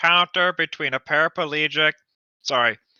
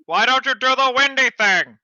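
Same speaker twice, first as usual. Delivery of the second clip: loud plea; 7 semitones higher, 5 dB louder than usual. Acted. Character pleading loudly.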